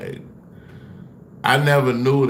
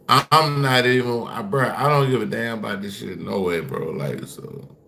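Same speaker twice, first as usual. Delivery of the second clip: normal talking voice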